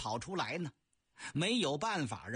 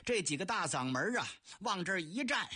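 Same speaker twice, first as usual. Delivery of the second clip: ordinary talk, nothing marked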